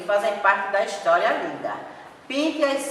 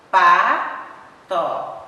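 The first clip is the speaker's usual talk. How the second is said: slow syllables; 8 dB louder than usual. Shown as a demonstration. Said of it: "Pronuncia devagar, pausadamente".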